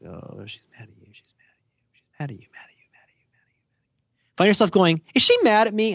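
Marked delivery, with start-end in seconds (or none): 0.1-4.4 barely audible whisper, looping obsessively; inner voice. Acted. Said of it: "you hear that voice and it goes". 5.2-5.9 high-pitched voiced question; said out loud. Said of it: "And actually say it out loud".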